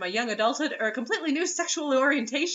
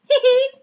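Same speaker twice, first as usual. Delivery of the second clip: mock giggle; 11 dB louder than usual. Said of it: "she giggled".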